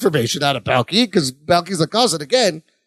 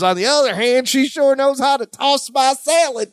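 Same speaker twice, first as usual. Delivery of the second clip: put-on Southern accent; 7 semitones higher than usual. Balky boasts about his wife in a Southern accent. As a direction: put on a high Southern twang and stretch the line. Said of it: "Balky says in a Southern accent".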